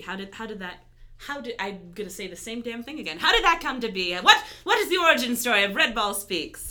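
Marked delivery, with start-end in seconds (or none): 3.2-6.7 louder, playful host voice with a laugh; lightly put-on announcer voice; Fillmore voice. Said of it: "I'm getting in my Fillmore voice again".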